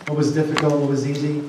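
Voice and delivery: ordinary talk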